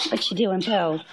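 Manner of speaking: normal talking voice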